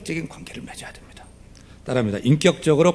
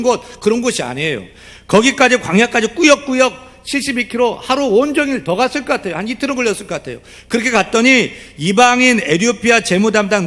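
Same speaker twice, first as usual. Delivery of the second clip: raised, pressing sermon voice; fervent preaching; 9 semitones higher, 4 dB louder than usual. Acted. Pastor urging the congregation, in fervent preaching. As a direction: drive the point home to the congregation.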